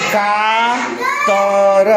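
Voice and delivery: कातर with each sound held long; sounded out slowly. Shown as a demonstration. Teacher showing pupils how कातर reads, drawn out slowly. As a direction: stretch कातर right out, sound by sound. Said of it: "क के बाद ऐसे".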